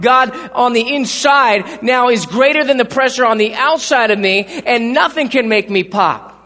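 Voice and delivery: loud, rising preacher's build; thundering sermon climax; full-force preaching. Acted. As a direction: preach it out to the whole room, climbing.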